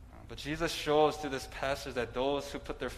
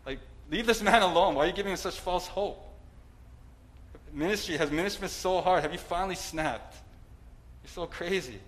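incredulous mock rebuke; 4 semitones higher than usual. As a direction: scold Jesus as if he has lost it. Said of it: "I'd be like".

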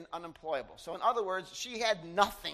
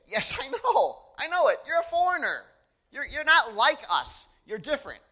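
high voiced Boaz; 5 semitones higher, 6 dB louder than usual. Boaz telling Ruth she's foreign.

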